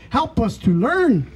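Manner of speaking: usual speaking voice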